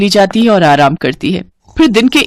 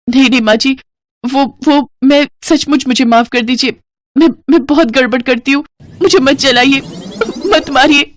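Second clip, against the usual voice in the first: higher, stammering frightened bride; higher voice, stuttering in fear; panicked pleading; 7 semitones higher than usual. Acted. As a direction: beg not to be punished, words tumbling. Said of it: "तू इतना घबरा क्यों रही है".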